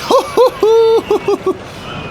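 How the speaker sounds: loud, high open laugh; loud burst of laughter. Real, not acted. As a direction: cackle at the disaster. Coaster goes wild.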